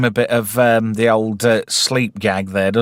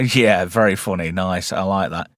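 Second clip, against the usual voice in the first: usual speaking voice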